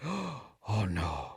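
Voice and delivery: tiny, low gasped dismay; hushed mock dread. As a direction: play hushed horror after a gasp. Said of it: "And, and he's like".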